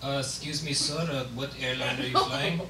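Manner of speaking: low, soft-spoken driver; hushed, polite shuttle driver; airport shuttle driver. Acted. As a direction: ask meekly, low and quiet. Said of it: "telling an airport shuttle driver".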